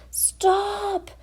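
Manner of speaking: high cry of stop; shouting. Acted. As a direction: call out to the jogging teacher. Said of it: "shouted Duff".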